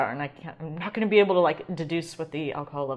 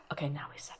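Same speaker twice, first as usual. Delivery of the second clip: whispering; 4 semitones lower, 12 dB quieter, much faster than usual. Real, not acted. Hushed before tasting.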